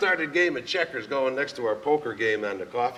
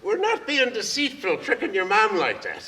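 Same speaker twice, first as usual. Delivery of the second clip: grandma's voice; 8 semitones higher, 4 dB louder than usual. Retold or imitated. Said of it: "how she explained that one".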